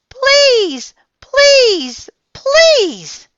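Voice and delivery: very high, very loud repeated please; begging shout. A narrator's reading. Girl screams at the octopus to let go, very high and loud. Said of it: "she shouted".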